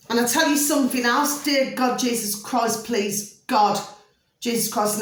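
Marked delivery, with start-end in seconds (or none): none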